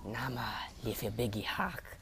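slow, rasping witch's whisper. Acted. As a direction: breathe it out like an old hag. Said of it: "she spoke in a rasping voice".

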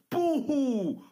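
loud, high mock boo-hoo wail; mock crying. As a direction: jeer like a crybaby. Mocking crying.

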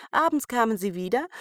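plain reading voice

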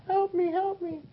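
very high falsetto cry for help; cartoon damsel in distress. Tied-up lady on the tracks cries for rescue, high falsetto plea. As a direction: squeak it out like a helpless cartoon heroine.

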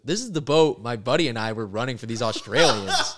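loud, high, laughing disbelief; retelling the boat photo with rising excitement; excited discovery. Retold or imitated.

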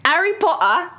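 high, hammed-up British accent; posh British accent, played for laughs. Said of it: "speak in a British accent".

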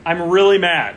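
half-joking anger. Real, not acted. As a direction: punch it out, annoyed but playful. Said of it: "I'm really mad now".

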